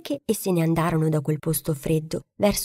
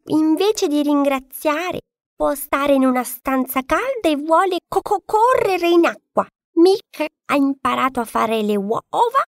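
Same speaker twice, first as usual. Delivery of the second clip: stuttering clucking hen; 7 semitones higher, 3 dB louder than usual. A narrator's reading. Hen scoffs at the duckling, clucking high. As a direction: cluck every word start like a flustered hen. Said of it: "continuò a parlare da sola".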